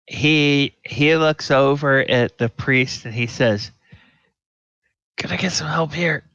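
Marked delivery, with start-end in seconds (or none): 5.2-6.2 high, quick plea; pitched-up, hurried mock appeal; acted call for help. Captain looking over at the priest, quick high call for help.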